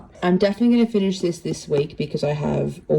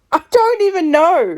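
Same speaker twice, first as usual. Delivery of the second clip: high-pitched, giddy disbelief; overwhelmed squeal; 11 semitones higher than usual. Real, not acted. Reader gushing over her book, overwhelmed and high. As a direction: voice shooting up, overwhelmed.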